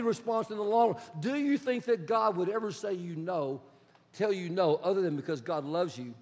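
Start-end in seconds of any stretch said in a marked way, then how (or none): none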